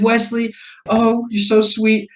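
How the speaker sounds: high, slow mock cooing; gushing girlfriend. Girlfriend to Wesley on finding the ring, gushing.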